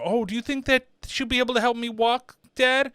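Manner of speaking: put-on hopeful kid. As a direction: play a kid asking dad for help. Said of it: "The kid's, you know, he can't walk".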